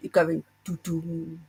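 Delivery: slow, low doo-doo; mimicked scary music. Friend mimicking his scary background music, ominous doo-doo. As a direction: sing out a slow spooky music sting. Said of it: "he's put the scary music on".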